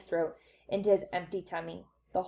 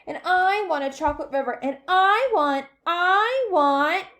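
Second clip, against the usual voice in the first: put-on demanding whine of a child; 8 semitones higher, 6 dB louder than usual. A narrator's reading. Child insisting on a chocolate river, demanding. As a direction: insist louder, stretching each want.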